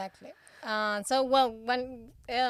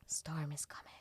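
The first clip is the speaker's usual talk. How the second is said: whisper; 6 semitones lower, 11 dB quieter than usual. Pretend. Ominous joke whisper.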